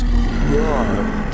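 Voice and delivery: loud roaring dragon growl; huge fiery dragon roar. Pretend. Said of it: "dragon noise".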